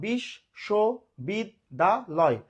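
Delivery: বিশ্ববিদ্যালয় slowly as "bish - sho - bid - da - loy"; five separate beats on বিশ্ববিদ্যালয়; syllable by syllable. Shown as a demonstration. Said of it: "পাঁচবারে". (বিশ্ববিদ্যালয় পাঁচ ভাগে, থেমে থেমে)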